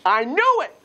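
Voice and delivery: high, gleeful I knew it; triumphant glee. Acted. Teacher voicing Abraham waking from prophecy, high gleeful triumph. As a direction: crow with triumph. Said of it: "and goes".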